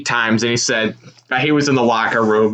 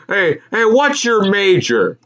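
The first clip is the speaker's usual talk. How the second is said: higher, slow friendly prod; chummy question; 8 semitones higher, slower than usual. Retold or imitated. Announcer quizzing a random student, higher chummy voice. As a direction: lean in and ask eagerly. Impersonating Walton quizzing a kid.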